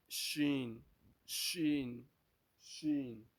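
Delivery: "Shin" with sh and long ee; teacher drills the letter "Shin"; Arabic Sheen drill. Shown as a demonstration. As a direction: model "Shin" in separate, falling repetitions. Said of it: "Let's pronounce them again".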